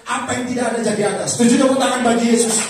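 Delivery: very loud, high sermon voice; full-force preaching. Real, not acted. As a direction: exhort the congregation with full power.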